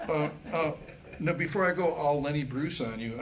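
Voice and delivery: usual speaking voice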